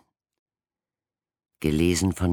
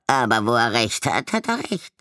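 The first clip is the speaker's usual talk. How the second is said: high, louder grudging voice; grudging raven; 8 semitones higher, 4 dB louder, faster than usual. A narrator's reading. Yellow-spotted raven grudgingly agreeing, high voice. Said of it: "meinte ein Gelbgetupfter".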